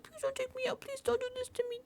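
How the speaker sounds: very high, soft begging squeak; mock pleading. Housemate begging in front of everybody, mock pleading. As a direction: mimic pitiful pleas way up high. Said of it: "pull that shit".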